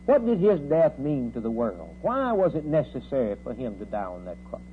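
usual speaking voice

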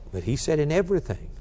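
ordinary talk

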